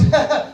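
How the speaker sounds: loud, high acted laugh; put-on burst of a mother's laughter; mock laugh. Mother in joke laughs at father, mock laugh. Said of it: "The mother starts laughing".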